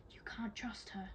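urgent unvoiced whisper; hushed, secretive whisper. A narrator's reading. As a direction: whisper a warning to your sister. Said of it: "Scarlet whispered to Teller".

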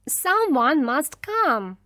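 loud, high, drawn-out cry; shouted plea. A narrator's reading. Mother screaming for rescue on the rock, shouted. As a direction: shout it slowly and insistently. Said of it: "she shouted".

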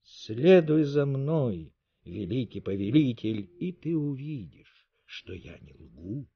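slow, fading to a hush; whispered. A narrator's reading. Sentry leading the king to the lovers, hushed. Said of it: "прошептал часовой".

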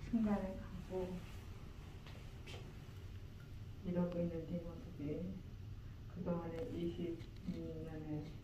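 quiet, tender goodbye; soft tender farewell. Real, not acted. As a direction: speak softly at a deathbed. Grandmother's quiet farewell to dog.

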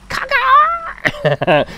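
very high loud laughing caw; bird caw. Pretend. Streamer reading chat's bird impression, high squawk.

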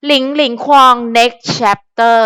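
each word of หลิงหลิงควอง next chapter drawn out slowly; slow title read. Shown as a demonstration. Vlogger reading the book title aloud, slow and deliberate. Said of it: "อ่า หลิงหลิงควองในตอนต่อไป".

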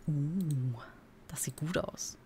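soft unvoiced whisper of approval. Real, not acted. Streamer admires the game screen, whispering. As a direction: hush it, pleased and impressed.

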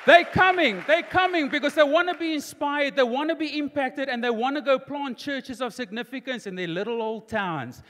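loud, high rallying cry; full-force preaching. Real, not acted. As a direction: rouse the congregation to stand.

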